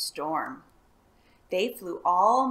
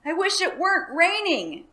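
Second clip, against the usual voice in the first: panting; 10 semitones higher, 8 dB louder than usual. A narrator's reading. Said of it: "panted the dragon".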